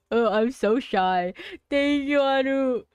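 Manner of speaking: loud, high flustered thanks. Real, not acted. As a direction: gush out thanks, embarrassed and giddy. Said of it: "so flustered".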